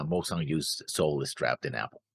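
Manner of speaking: ordinary talk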